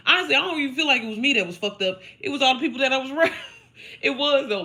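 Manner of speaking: loud laughing confession; laughing, high and loud. Real, not acted. Telling viewers about users around her, laughing loud. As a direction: crack up at the truth.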